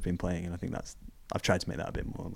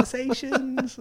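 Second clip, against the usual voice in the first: much higher, soft mock mum voice; imitating mum; 5 dB quieter, slower than usual. Son imitating his mum praising his game, high and soft. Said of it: "She was like".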